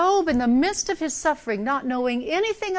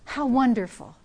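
ordinary talk, nothing marked

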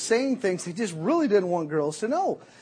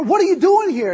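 much higher and louder shout; yelling through the door; 12 semitones higher, 13 dB louder than usual. Retold or imitated. Girl yelling at her date through the door. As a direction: yell it through a closed door. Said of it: "she's yelling at you through the door".

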